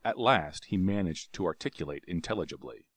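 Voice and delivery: ordinary delivery, nothing marked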